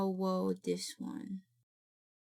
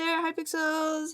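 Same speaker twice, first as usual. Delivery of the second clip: high pet-talk voice; 7 semitones higher, slower than usual. Real, not acted. Greeting pets in cute voice.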